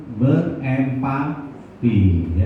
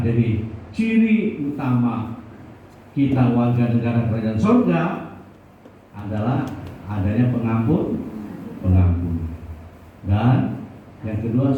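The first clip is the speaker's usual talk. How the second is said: normal talking voice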